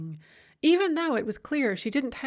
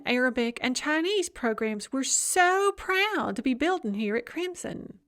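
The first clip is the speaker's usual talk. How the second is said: higher, saccharine sing-song lecture; 6 semitones higher than usual. A narrator's reading. Facilitator addressing the workshop, in a higher sugary sing-song. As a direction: gush sweetly like a fake-nice facilitator. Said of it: "the sing-song voiced woman".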